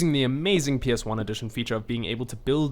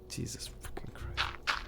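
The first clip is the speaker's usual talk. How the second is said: whispered, exasperated swearing; whispered curse; 12 dB quieter, slower than usual. Real, not acted. Whispered curse after the attack.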